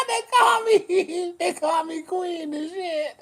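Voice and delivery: high squealing laugh through the words; can barely get it out for laughing; laughing hard. Real, not acted. Laughing at her own nickname to the viewers. Laughing while saying queen title.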